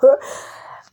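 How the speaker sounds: short loud unvoiced laugh; burst of laughter. Real, not acted. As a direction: laugh off the slip. Laughs at own slip in ad.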